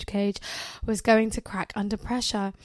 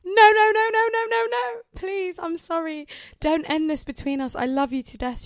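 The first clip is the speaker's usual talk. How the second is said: high, loud pleading that fades to a tearful beg; frantic no-no-no that trails into desperate apology; hysterical pleading; 8 semitones higher, 3 dB louder than usual. Acted. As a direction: beg hysterically, then sink into sobbing pleas. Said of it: "she said hysterically".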